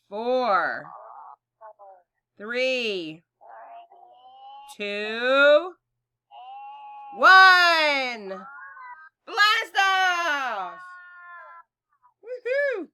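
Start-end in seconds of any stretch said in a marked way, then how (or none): 4.8-10.8 building to a loud shout; getting very loud. Shown as a demonstration. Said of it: "then get very loud". 12.2-12.9 excited cheer. Acted. After blast off.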